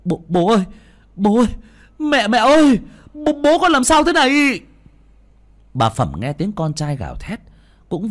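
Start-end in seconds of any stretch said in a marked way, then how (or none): 0.0-4.6 high, loud calls rising higher; panicked cry. Acted. Son finding his father collapsed, crying out in panic. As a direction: stammer out a frightened cry for help. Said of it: "thằng Được mới kêu lên".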